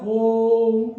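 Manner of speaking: slow ओम with the ओ held long; drawn-out Om. Shown as a demonstration. Teacher showing students a chanted ओम, ओ drawn out long. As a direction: stretch the ओ of ओम, then close on म. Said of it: "उसको लंबा खींचा जाता है".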